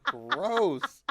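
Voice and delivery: cracking up in mock disgust; laughing disgust. Real, not acted. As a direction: squeal gross through laughter. Laughing disgust after gaffe.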